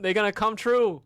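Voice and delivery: booming declaration, voice pushed up; loud proclaiming. Real, not acted. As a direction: proclaim it to the whole chat.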